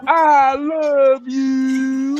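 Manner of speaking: very slow, crying-style I love you; drawn-out plea. Acted. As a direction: stretch it out like a tearful plea. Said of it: "I said".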